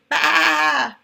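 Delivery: loud bleating baa; sheep baa. Acted. Teacher voicing the sheep for her class, a loud bleat.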